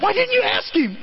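very high mock-indignant wife; scolding wife. Imitating wife scolding husband.

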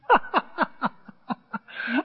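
high staccato laugh. Real, not acted. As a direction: laugh in short separate bursts. His own laugh.

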